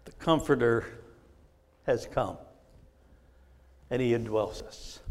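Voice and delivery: low, soft and spaced out; hushed reverence. Real, not acted. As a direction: let each phrase settle quietly. Hushed statement after the climax.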